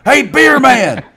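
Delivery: loud, high hawker's call, drawn out slowly; hey beer man, slow booming hawker cry; vendor yelling. Retold or imitated. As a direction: bellow it down the aisle like a stadium beer vendor.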